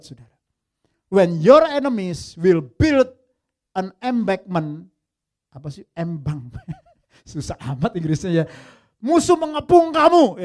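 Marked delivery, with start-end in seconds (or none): 1.1-4.9 much louder and slow, stressing your; prophetic proclamation. Shown as a demonstration. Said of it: "Yesus bernubuat". 9.0-10.4 louder, higher declaration; forceful proclamation. Acted. Preacher translates prophecy forcefully.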